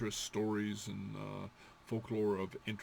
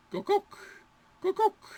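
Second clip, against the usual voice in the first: high hen cluck; 6 dB louder than usual. Shown as a demonstration. Teacher clucking like a hen, high.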